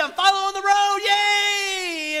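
high, loud squeal of joy; excited Dorothy. Retold or imitated. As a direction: shout it like a thrilled little girl. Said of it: "she's like, all excited".

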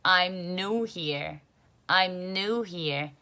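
slow American read, new as a plain noo; "I'm new here" drilled slowly in American style with noo; 미국식 발음으로 천천히; American English. Shown as a demonstration. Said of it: "미국".

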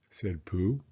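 ordinary delivery, nothing marked

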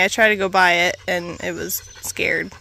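normal talking voice

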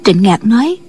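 ordinary delivery, nothing marked

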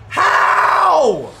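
high, full-volume outburst of disbelief; real rage shout. Real, not acted. Gamer railing at Durant hitting fadeaway threes, furious shouting. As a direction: yell it out loud and high, outraged. Rages at opponent's shots in game.

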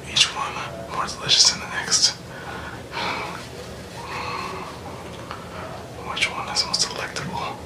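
soft unvoiced creepy stalker whisper; heavy breathing phone whisper. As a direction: whisper like a stalker savouring candy. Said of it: "your creepiest stalker voice".

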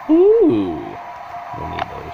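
thrilled ooh, dropping to a hush; excited gasp then hush. Real, not acted. Excited at loot found.

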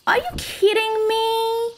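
high incredulous complaint; exasperated disbelief. Real, not acted. Streamer told the pelt is abandoned, exasperated disbelief. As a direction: react in real exasperation. Pelt lost, game message.

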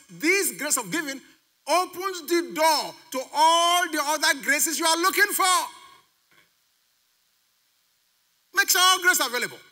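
high, loud, ringing declaration; full-force preaching. Real, not acted. Preacher promising congregation all graces through giving, full-force preaching. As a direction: build to a pulpit climax, stretching "all".

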